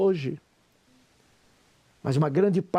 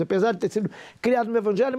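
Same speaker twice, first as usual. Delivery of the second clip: usual speaking voice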